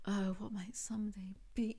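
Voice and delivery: soft whispered wistful line; dreamy poetic voice. Retold or imitated. Mocking the book's wistful Zoe, soft whisper. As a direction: breathe it like a dreamy poet.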